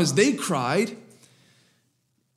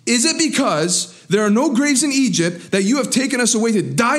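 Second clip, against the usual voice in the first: acted grumbling, pitched higher and pleading; a complaining crowd, whining and accusing; whiny complaint; 6 semitones higher than usual. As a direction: voice the Israelites moaning at Moses. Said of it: "Their cry turned into a complaint".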